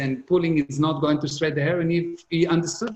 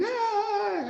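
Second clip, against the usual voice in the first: mock child wail; 4 dB quieter than usual. Son crying in meltdown.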